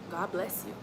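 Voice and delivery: usual speaking voice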